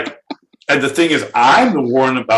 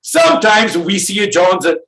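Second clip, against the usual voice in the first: put-on high, strained failed Swiss accent; a bad comedic Swiss accent, higher; attempted Swiss accent; 7 semitones higher than usual. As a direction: push a fake Swiss voice way up. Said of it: "See, I can't do it".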